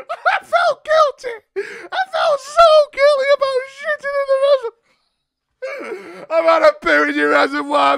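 high, very loud fake crying; hammed-up sobbing shout. Caller shouting fake sobs down the phone. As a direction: wail it out like a mock breakdown. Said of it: "caught up in my emotions".

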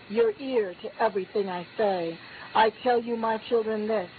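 ordinary talk

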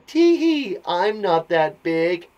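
high "tee hee", then slow cheeky line; squeaky giggle easing into drawn-out words; giggly little spider. Acted. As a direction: play a tittering cartoon spider. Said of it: "And the spider thinking".

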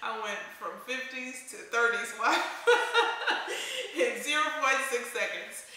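words broken by laughter, bursts of laughs; laughing at herself. Real, not acted. Vlogger teasing herself to viewers about sounding old-fashioned, laughing. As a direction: laugh through the self-mocking line. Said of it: "I sound so".

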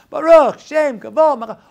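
loud, high proclaimed prayer; shouted out. Shown as a demonstration. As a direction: call the prayer out boldly. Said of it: "Yom Kippur we shout it out".

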